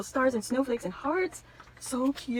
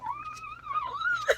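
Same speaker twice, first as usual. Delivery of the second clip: high laughing squeal; 4 dB quieter than usual. Real, not acted. Laughing fit during unboxing.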